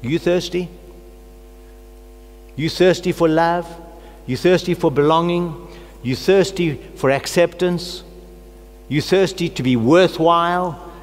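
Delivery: urging the congregation to admit their thirst; preaching questions. Real, not acted. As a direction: keep hammering the word thirsty. Preacher repeating rhetorical questions.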